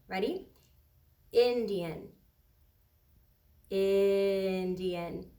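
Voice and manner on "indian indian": "Indian" drawn out slowly, first vowel stretched; said really slowly. Shown as a demonstration. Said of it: "sometimes I say the word really slowly".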